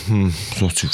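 ordinary delivery

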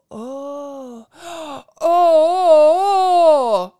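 drawn-out high "ohhh", swelling very loud; gasped ohs building to a long cry; exclaimed "oh". A narrator's reading. Antoine realizing who tricked him, crying out "oh". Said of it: "riep Antoine".

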